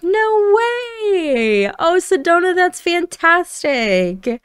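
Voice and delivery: high, drawn-out amazed delight; thrilled disbelief. Acted. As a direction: react to wonderful news with a soaring, stretched-out cheer. Grandpa-to-be reacts to news.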